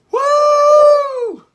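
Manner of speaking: loud high-pitched whoop of pain. Real, not acted. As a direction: whoop out at the heat. Mouth on fire from spice.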